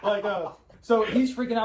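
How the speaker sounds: laughing through the words; real laughter while telling; giggling out the line. Real, not acted.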